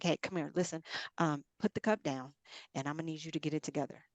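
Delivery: soft, quick pep talk pulled aside; quiet firm talk. Retold or imitated. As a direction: hush them into focus, fast and soft. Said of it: "that was the tone that I got".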